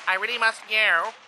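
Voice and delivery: usual speaking voice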